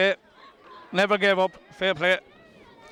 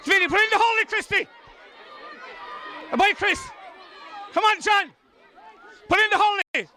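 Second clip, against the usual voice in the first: yelling at players; 10 semitones higher than usual. Real, not acted. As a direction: shout at the players on the pitch.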